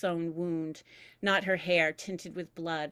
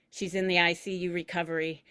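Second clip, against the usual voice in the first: ordinary delivery, nothing marked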